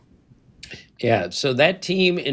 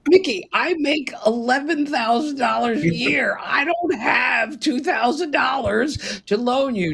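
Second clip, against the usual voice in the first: higher, pleading retort with a laughing cry; exasperated reply; 8 semitones higher than usual. Retold or imitated. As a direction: protest you can't lend money. Retold pleading reply to Mickey.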